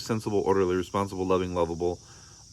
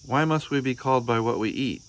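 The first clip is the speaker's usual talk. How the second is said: put-on child's voice; 5 semitones higher than usual. Child Arthur voiced in story.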